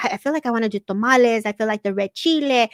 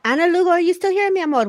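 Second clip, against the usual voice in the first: raised, higher call across the room; 8 semitones higher, 3 dB louder, faster than usual. Real, not acted. Host calling out to a viewer who dropped away, higher, louder call. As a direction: call out warmly to find her.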